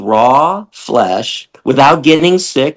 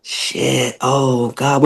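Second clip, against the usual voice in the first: humbled realization; 8 dB quieter, much slower than usual. Shown as a demonstration. Showing how to respond to the truth, soft and humbled. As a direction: say it like the truth just hit you. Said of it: "Everybody should be saying".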